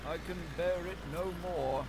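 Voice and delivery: repite la frase, más alto, al borde del colapso; repeated with despair. A narrator's reading. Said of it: "he repeated".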